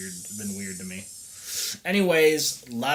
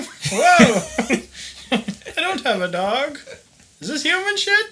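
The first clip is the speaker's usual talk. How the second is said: put-on high, shouting old man, disgusted; outraged old geezer yelling; 6 dB louder than usual. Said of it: "I better have an old guy voice".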